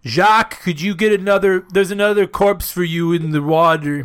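put-on loud French accent, calling out. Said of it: "French accent".

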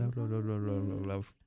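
talking around a bottle in the mouth; words muffled by a bottle at the lips. Real, not acted. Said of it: "with a bottle in my mouth".

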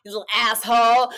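hammed-up outrage; mock mad. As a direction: play up indignant annoyance. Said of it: "I'm so mad".

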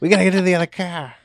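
put-on loud, high, hurried Boston accent; panicked mock-Boston yell. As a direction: shout it fast in a hammed-up Boston accent. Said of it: "You just don't know what anybody is saying".

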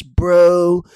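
loud, high stoner "bro"; Riddle impression. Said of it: "he just talks like this".

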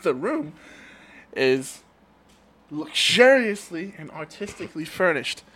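slow, careful play reading; reading stage directions. Acted. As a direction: set the scene slowly.

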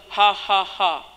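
lightly imitated fake laugh; put-on laugh. Preacher asking why he was laughing, lightly mimicked put-on laugh. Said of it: "why was he going".

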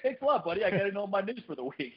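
high, sarcastic thank-you. Retold or imitated. Mock thanks to the neighbor.

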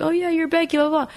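high-pitched mimicked recognition; light, high quoted voice; imitated acquaintance. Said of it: "and they're like".

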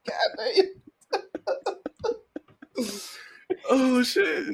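real laughter easing off; laughing tail. Real, not acted. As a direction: still high, catching breath after laughing. Recovering from laughter.

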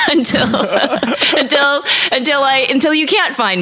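high-pitched, words breaking into laughter; giggling through repeated false starts; laughing. Real, not acted. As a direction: crack up while trying to finish the sentence. Cracking up at own joke.

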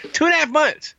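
high, exasperated disbelief; incredulous exclamation. Real, not acted. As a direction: throw up your hands at it. Repeats the complaint in disbelief.

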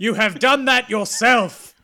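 loud, hammed-up declamation; mock dramatic rebuke. Mentor answering a fallen pupil, loud melodramatic rebuke. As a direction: thunder the accusation back theatrically.

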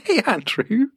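warped high-pitched digital voice; garbled pitch-shifted call audio; glitchy jumping pitch. Real, not acted. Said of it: "Why do we sound so weird?".

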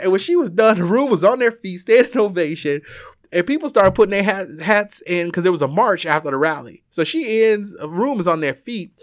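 building thrill of the crowd; excited. Real, not acted. Excited retelling of ovation.

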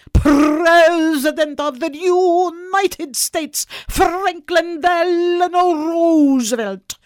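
drawn-out, high, booming announcement; grand proclamation. Acted. Popper trumpeting his candidate to his little girl, grandly proclaiming. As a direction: proclaim each word slowly and loudly, like a campaign announcer.

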